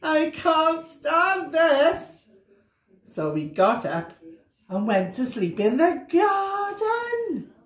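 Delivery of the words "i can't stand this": high, drawn-out and very slow complaint; exasperated Mister Bear. Acted. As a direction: stretch every word like a bear who can't take any more. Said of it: "said Mister Bear".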